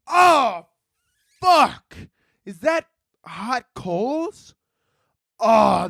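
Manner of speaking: high-pitched dismayed shock; aghast saint. Acted. Saint spotting hot coals from above, aghast. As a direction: react high and stunned, word by word. Saint reacts to what he sees.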